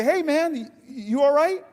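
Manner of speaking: high, breezy call to a stranger; casual, high-pitched check-in; too-casual tone. Retold or imitated. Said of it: "I realize my tone wasn't right".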